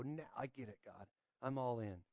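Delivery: hushed vow. Retold or imitated. Preacher voicing a believer's hushed vow to God. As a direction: murmur it like a private promise.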